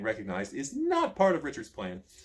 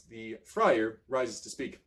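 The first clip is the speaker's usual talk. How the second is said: ordinary delivery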